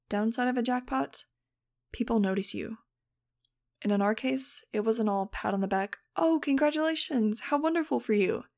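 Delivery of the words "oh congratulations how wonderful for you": high, sugary fake praise; mock congratulations. A narrator's reading. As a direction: gush like a polite stranger. Mock polite crowd quote.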